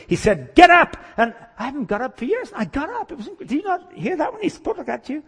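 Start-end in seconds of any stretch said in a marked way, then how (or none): 0.5-1.0 loud, high commanding shout; booming order that leaps up in pitch; authoritative command. Acted. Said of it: "he spoke with such authority". 1.2-5.2 high, incredulous excited voice; hammed-up amazed convert; excited testimony. Healed man excitedly argues his experience.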